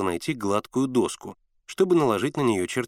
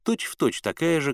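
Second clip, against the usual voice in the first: plain reading voice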